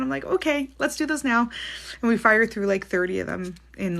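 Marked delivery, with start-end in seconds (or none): 0.2-1.5 retold eager reply. Retelling her reply to excited clients.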